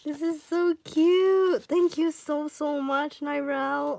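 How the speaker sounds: high, gushing thanks; giddy, excited. Real, not acted. Fan thanking the artist, giddy and excited. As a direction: gush it high, giddy with delight. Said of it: "giddy and excited".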